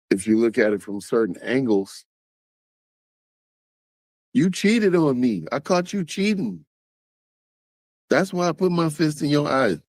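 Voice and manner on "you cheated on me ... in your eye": pitched well up, aggrieved; whiny excuse, abuser voice. Retold or imitated. Abuser justifying the punch to partner, mock aggrieved whine. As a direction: whine like a man caught out.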